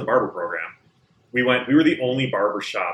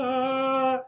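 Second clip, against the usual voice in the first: nervous squeak. Retold or imitated. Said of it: "did your voice crack?".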